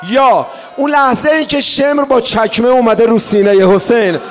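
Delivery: loud rising lament, crying out the questions; impassioned mourning sermon; preaching at full force. Acted. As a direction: build to an anguished public outcry.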